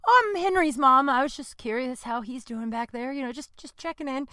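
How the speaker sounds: high-pitched, badly faked nonchalance; imitated fake casual. Henry's mom checking on her cat, trying to sound casual. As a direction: start high, trail off softly as if just passing. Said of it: "Trying to look casual".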